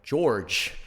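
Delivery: put-on over-pronounce 'George' in an American accent. Customer giving in to American barista, exaggerated American accent. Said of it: "I'll say, "George".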